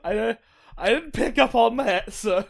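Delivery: high, laughing through the words; cracking up while talking. Real, not acted. Laughing at the joke.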